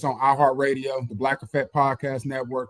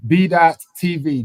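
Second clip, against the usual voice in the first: normal talking voice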